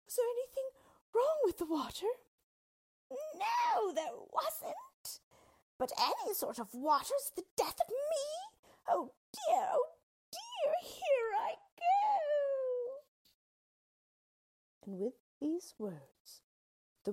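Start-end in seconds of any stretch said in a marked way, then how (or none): 0.0-2.1 high, loud anxious question; high-pitched worried little girl; frightened girl. A narrator's reading. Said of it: "who was really frightened". 3.1-13.0 very high, loud witch wail; wailing melting witch. A narrator's reading. Witch wails while melting away.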